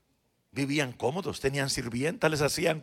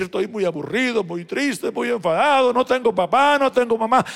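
mock complaint; 7 semitones higher, 10 dB louder than usual. Retold complaint, preached loudly.

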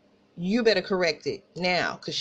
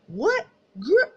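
high-pitched affronted outburst, louder on the second word; indignant disbelief; 9 semitones higher, 4 dB louder than usual. Retold or imitated. Replaying her reaction to a classmate's insult, indignant disbelief. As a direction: react as if you can't believe the nerve.

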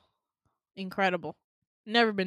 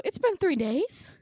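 high, loud, drawn-out disbelief; astonished; 8 semitones higher, 5 dB louder than usual. Retold or imitated. Patient learns how long she was out, mock astonished. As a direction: re-enact being stunned by the news. Reacts to learning time passed.

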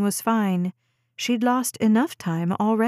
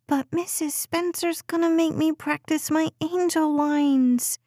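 put-on high child's voice begging to stay; a preschooler pleading to stay upstairs; pleading little girl; 7 semitones higher than usual. A narrator's reading. Daughter whining to mom.

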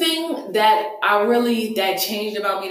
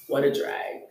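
sighing drag; 5 semitones lower, 5 dB quieter than usual. Real, not acted.

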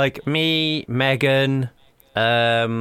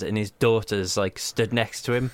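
normal talking voice

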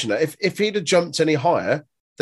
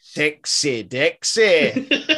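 very slow, stretched Scottish "Sexy Dexy"; Scottish accent; much slower than usual. Retold or imitated. Co-host showing what the Scottish accent adds, in a Scottish accent. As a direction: savour "Sexy Dexy" in a hammed Scottish twang. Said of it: "doing a Scottish accent gives stuff".

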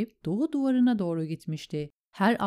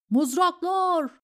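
high, raised shout; battle call; 12 semitones higher, 4 dB louder than usual. A narrator's reading. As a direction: call urgently for spears. (yüksek sesle savaş çağrısı)

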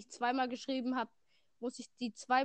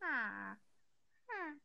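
Minecraft villager sound; 5 dB quieter, slower than usual. Retold or imitated. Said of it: "Villager Sound nachmachen".

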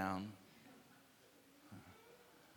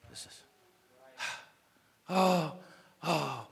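soft, repeated helpless murmur; despairing murmur; 4 semitones lower, 9 dB quieter, faster than usual. Acted. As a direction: wring the words out quietly.

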